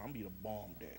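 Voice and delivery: usual speaking voice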